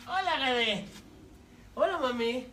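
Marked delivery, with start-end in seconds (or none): none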